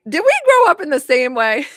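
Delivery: high and loud, thrilled to relate; a delighted can-you-believe-it question; excited disbelief. Retold or imitated. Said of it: "I'm like".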